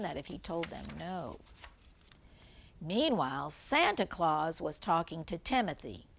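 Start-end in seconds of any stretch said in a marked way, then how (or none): none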